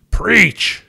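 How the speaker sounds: high, punched one-word shout; loud shout. A narrator's reading. Carl cheering on the pastor from the lobby, shouted loudly. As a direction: shout it as a rousing urge to the pastor. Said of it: "in an even louder voice, he shouted".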